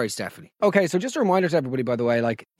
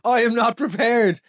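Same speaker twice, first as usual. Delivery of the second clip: high, hammed-up panic; a host playing at being flustered; mock alarm at tears on a comedy podcast; 6 semitones higher than usual. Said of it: "And I was like".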